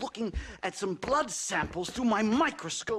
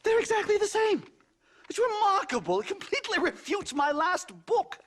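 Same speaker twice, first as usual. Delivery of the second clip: higher and louder, thrilled by the discovery; excited outburst; 11 semitones higher, 3 dB louder than usual. Acted. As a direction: burst out with the amazing result. Scientist thrilled by his discovery.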